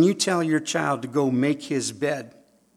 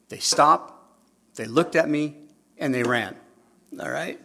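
usual speaking voice

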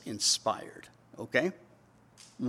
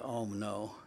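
low, very soft mock groan of reluctance; imitated reluctant aside; eye-rolling dismay; 5 semitones lower, 11 dB quieter than usual. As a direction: mutter it with an eye-roll, acting him out. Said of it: "he rolled his eyes".

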